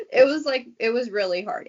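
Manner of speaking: tearful, starting high then steadying; getting emotional. Real, not acted. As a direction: push on through real tears. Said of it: "getting emotional".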